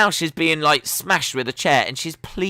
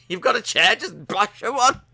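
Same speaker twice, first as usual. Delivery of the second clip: higher, egging her on; playful cheer from the couch, pitched up; mock egging on; 7 semitones higher than usual. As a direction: urge her to swing the chair. Egging on wrestler.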